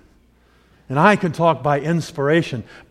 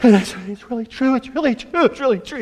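dad out of breath; 8 semitones higher than usual. Retold or imitated. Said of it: "he's, he's like".

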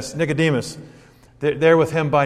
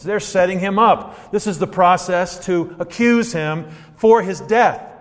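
ordinary talk, nothing marked

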